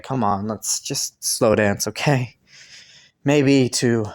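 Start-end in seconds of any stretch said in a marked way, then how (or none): 0.0-2.4 soft invitation. Acted. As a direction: coax the partner gently into a dance. Inviting partner to slow dance.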